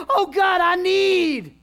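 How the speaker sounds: high loud cry to God; shouted plea. Acted. Daddy coming forward to beg God, loud cry. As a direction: call out to God at full voice.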